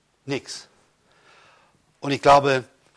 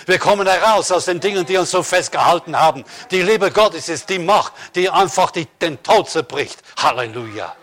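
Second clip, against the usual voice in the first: laute, feurige Predigt mit Nachdruck; volle Predigt; 4 semitones higher, 6 dB louder than usual. Acted. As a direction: steigert sich in die Verkündigung hinein, lauter. Preaching at full force. (Prediger ruft der Gemeinde laut den Sieg zu)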